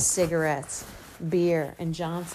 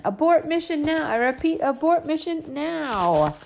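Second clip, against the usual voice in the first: commanding yell; 10 semitones higher, 5 dB louder than usual. A narrator's reading. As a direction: shout like a space commander. Kid yelling space command.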